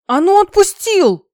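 very high, very loud slow yell; furious demand. A narrator's reading. Dragoness caught in another dragon's paws, furious yell. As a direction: shriek the order at full force.

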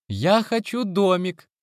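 high, slow eager chirp; chirping little girl. A narrator's reading. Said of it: "пылко прощебетала малышка".

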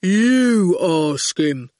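very slow, dismissive goon. A narrator's reading. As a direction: drawl it back at him. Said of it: "said the goon".

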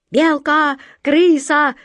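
high, loud pirate cries; shouting. A narrator's reading. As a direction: shout it out like a rattled crew. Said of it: "нестройно закричали пираты".

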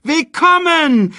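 high, loud, drawn-out cheer; jubilant shout. A narrator's reading. Crowd greets the newborn prince, jubilant shout. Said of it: "riefen sie".

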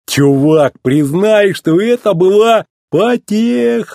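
high, drawn-out gleeful singsong; merry, musical teasing; joyful singsong. A narrator's reading. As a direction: crow it joyfully, stretching the last word. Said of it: "радостно пропел мэтр".

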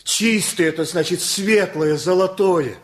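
put-on father beaming at the mother over their children, overjoyed. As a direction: gush with delight, almost singing it. Father delighted about the children.

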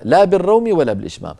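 ordinary delivery

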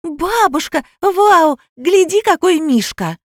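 put-on high-pitched thrilled little girl; joyful yelling. A narrator's reading. Girl shows grandmother the bear, high joyful yell. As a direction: squeal with delight like a five-year-old. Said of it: "Таня радостно заорала".

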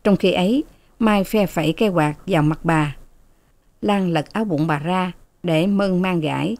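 ordinary delivery

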